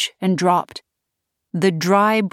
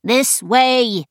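higher, louder impatient urging; politely impatient; 6 semitones higher, 3 dB louder than usual. A narrator's reading. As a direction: hurry her along. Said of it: "Spike said impatiently".

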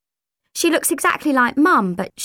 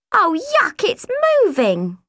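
high disgusted squeal; 8 semitones higher than usual. Acted. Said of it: "Rochelle squealed".

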